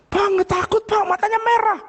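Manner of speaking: high-pitched mock panic; frightened helper. Frightened helper warns the boss about a red-eyed stranger, panicky. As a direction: imitate a scared servant crying out in a high voice. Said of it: "pembantu saya ini ketakutan".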